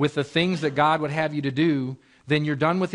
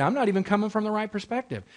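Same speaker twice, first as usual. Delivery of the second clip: ordinary talk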